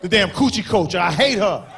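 ordinary talk, nothing marked

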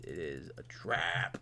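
slow breathy whisper. Acted. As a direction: whisper it like a secret. Whispered repeat of quote.

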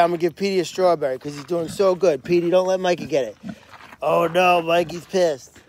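usual speaking voice

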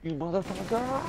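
high furious outburst; raging gamer. Real, not acted. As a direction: erupt at the teammate. Friend shot him in game.

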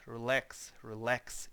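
relax, relax, each stressed on LAX; relax, relax as re-LAX, each with a rising end; stress on second syllable. Shown as a demonstration. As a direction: say relax, relax as a repeated model, weight on the last syllable. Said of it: "Тобто так от".

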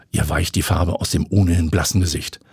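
ordinary delivery

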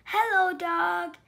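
high, loud cheerful hello; cheery greeting. Acted. Duck greeting Sheep from his bike, bright and loud. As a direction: sing out a friendly hi. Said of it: "said Duck".

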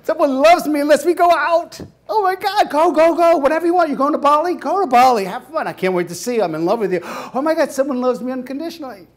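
high, loud, giddy rush; lovestruck gushing. Retold or imitated. Boyfriend in the honeymoon stage, hammed giddy gushing. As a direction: swoon like a smitten teen.

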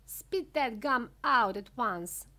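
slow, loud command; heavy, deliberate storybook command; stern order. A narrator's reading. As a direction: order it as a firm father. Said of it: "ordered Mister Beauregard".

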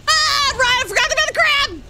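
high, loud panicked cry; startled yelling. Real, not acted. Player surprised by the forgotten crab, startled yelling. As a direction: yelp it in sudden alarm.

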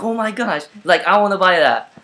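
high, thrilled gush; light up at finding the laptop; excited. Retold or imitated. Buyer finding the laptop she wanted, excited. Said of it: "I was like".

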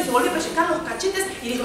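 plain reading voice

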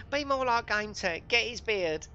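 high, loud put-on wife voice; mock moaning wife in a put-on accent; wife impression. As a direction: pitch it right up as a nagging wife. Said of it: "Why has your wife got that accent?".

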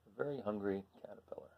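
hushed, fast whisper of the title; whispered title for children. Acted. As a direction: breathe the title like a secret.

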